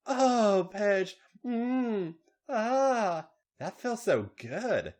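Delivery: high drawn-out moan; acted moans, well above the narration. A narrator's reading. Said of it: "Charlotte moaned".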